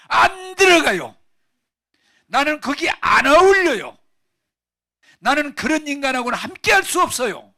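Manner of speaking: high, bellowed refusals with stretched words; angry shouting. Acted. Older brother rejecting his father's plea to join, angry shouting. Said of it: "제가 소리 지르니까".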